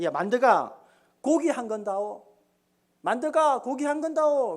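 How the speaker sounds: lordly nobleman ordering a lowly butcher; hammed-up contemptuous command; dismissive, high-handed. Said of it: "무시하는 투로 이렇게 말했습니다".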